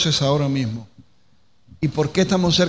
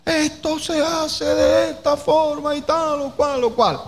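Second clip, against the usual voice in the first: high, drawn-out scolding yell; exasperated shouted demand, acted out; mock shouting; 11 semitones higher than usual. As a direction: holler instructions as if they won't understand. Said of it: "entonces le gritamos".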